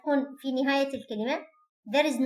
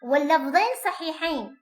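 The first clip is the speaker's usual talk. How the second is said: ordinary talk, nothing marked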